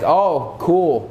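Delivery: unimpressed, low-key "oh, cool"; understated, indifferent acknowledgement; joyless thanks. Acted. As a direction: accept a gift with no joy at all. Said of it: "but not with joy".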